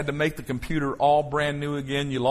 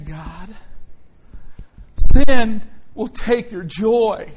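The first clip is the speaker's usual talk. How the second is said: higher and louder, slow and driving; full-force preaching; 6 semitones higher, 7 dB louder, slower than usual. Acted. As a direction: preach it to the back row.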